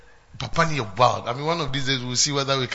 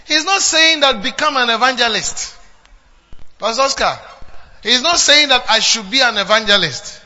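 rising preaching; 8 semitones higher, 8 dB louder than usual. Acted. Preacher building point.

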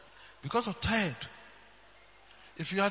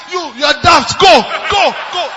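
dismissive shout; 8 semitones higher, 13 dB louder than usual. Retold or imitated. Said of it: "I said".

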